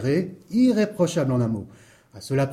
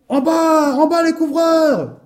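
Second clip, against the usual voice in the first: high, loud bellow; furious yell; 7 dB louder, slower than usual. Acted. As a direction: bellow angrily up at the roofers. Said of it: "hurla-t-il".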